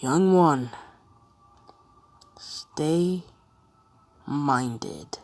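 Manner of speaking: lightly voiced old man, drawn-out words; a touch of an old sage calling out with long pauses; mysterious old man. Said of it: "starts hearing voices. ... the same old man".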